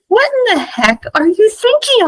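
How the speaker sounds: high-pitched mock scolding; retold indignation. He scolds August over his thinking, high indignant retelling. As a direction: exclaim it in high, incredulous disbelief.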